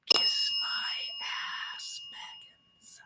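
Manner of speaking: breathy whisper, slow and sly; a sly, slow whispered jab at a rival; whispered taunt. Acted. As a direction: hiss the insult under your breath.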